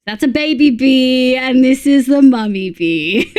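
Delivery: amused streamer showing off a giant bee, laughing. Real, not acted. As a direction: giggle while naming the mummy bee.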